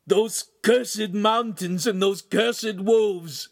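high, slow, loud grieving cry; anguished cry. A narrator's reading. Ugolino cursing the wolves, anguished cry. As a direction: cry out the curse in grief. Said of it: "Ugolino cried".

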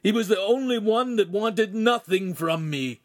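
high, pained grieving voice; grieving cry. A narrator's reading. Ugolino mourning the father, grieving cry. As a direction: mourn the one friend aloud. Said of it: "Ugolino cried".

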